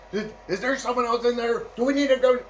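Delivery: high anxious questions; a nervous guard ready to bolt; panicky guard. Retold or imitated. A jittery guard asking if they need to leave, high and anxious.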